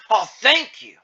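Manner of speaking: high, loud burst of relief; relieved exclamation. Acted. Zeke finally called from the line, relieved. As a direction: exclaim it loudly, pitch jumping up.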